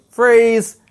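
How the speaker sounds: loud, high shouted command; shouting freeze. Retold or imitated. Grown-up at home shouting freeze, loud and high. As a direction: shout it like the teacher would. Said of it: "keep shouting".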